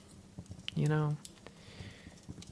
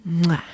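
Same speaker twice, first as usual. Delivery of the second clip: a loud smacking air kiss; kiss sound; 4 semitones higher, 9 dB louder than usual. Acted. To partner cuddling on the couch, a loud smacking kiss. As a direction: blow a big playful kiss.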